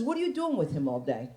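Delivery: low, soft suspicious grumble; lightly voiced jealous accusation; jealous boyfriend voice. Jealous boyfriend accusing her at the mall, low and soft. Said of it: "he says".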